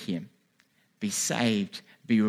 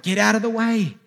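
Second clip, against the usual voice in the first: urgent warning yell; 12 semitones higher, 8 dB louder than usual. Acted. Shouting to someone about to get hit by a car, urgent yell. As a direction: yell it high and loud. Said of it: "You'd yell out".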